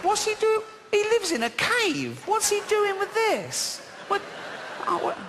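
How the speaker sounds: high mock bafflement at Noah's picture; incredulous high voice. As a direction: squeal at the silly picture. Mocking a Bible picture.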